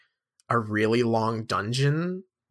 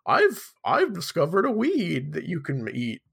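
put-on wavering old guy voice with big pitch swoops; kooky old Albus Dumbledore; 3 semitones higher, 3 dB louder than usual. Old wizard announcing a discovery to the students, kooky old-man voice. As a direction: play a dotty old man, swooping up high. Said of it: "an old guy voice for him".

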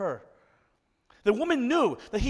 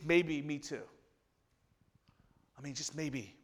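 hushed hope; 6 semitones lower, 8 dB quieter than usual. Acted. Woman's hopeful inner thought.

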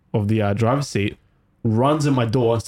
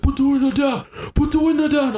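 imitating a frantic man shouting through the window; shouted demand; 12 semitones higher than usual. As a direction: yell it like a stranger pounding on the glass. Man banging on car window.

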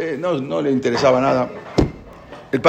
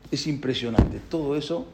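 awed hush; 9 dB quieter than usual. Real, not acted. Awed soft remark.

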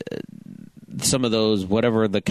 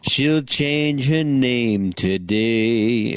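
mock announcer; much slower than usual.

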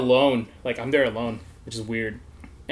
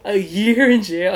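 higher, louder and slower in horror; stunned by a year in jail; shocked disbelief; 6 semitones higher, 5 dB louder, slower than usual. Retold or imitated. Hearing his sentence.

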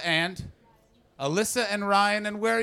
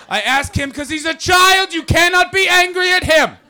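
shouting; 11 semitones higher, 11 dB louder than usual. Real, not acted. Shouted joke at crowd.

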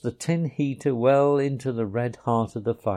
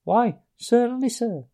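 higher, polite little voice; respectful Field Mouse; 11 semitones higher than usual. A narrator's reading. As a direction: answer the gentleman politely. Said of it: "replied the Field Mouse respectfully".

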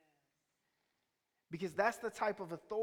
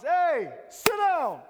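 put-on yelling at kids; 8 semitones higher, 12 dB louder, much slower than usual. Teacher yelling at rowdy kids, loud and high. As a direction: shout sit down over the noise. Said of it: "talking at the top of their lungs".

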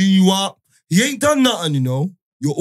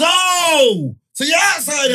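high mock excitement; 9 semitones higher, much slower than usual. Imitating friend's excited reaction.

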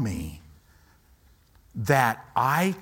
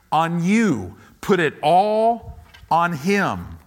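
higher, louder pulpit preaching; full-force preaching; 5 semitones higher, 4 dB louder than usual. Acted. Pastor urging the congregation to trust God, at full force. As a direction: preach with rising fire.

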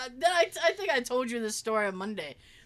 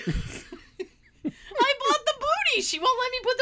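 high, slow, laughing through it; 13 semitones higher, 4 dB louder, slower than usual. Real, not acted. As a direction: giggle the words out. Laughing about the dog booty.

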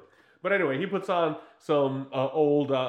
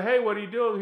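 higher, louder bright phone hello; cheery greeting; 8 semitones higher, 4 dB louder than usual. Retold or imitated. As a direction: call him up all chirpy and high. Novella phones Robert cheerfully.

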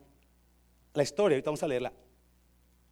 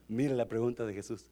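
ordinary talk, nothing marked

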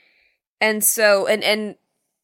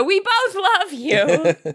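high, slow, sugary sing-song; sing-song mom; 9 semitones higher, slower than usual. Retold or imitated. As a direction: drag it out like a doting mom. Mom calling from car window.

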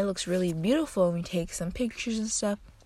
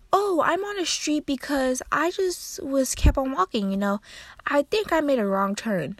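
lightly voiced grandma; 7 semitones higher than usual. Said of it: "And then she's like".

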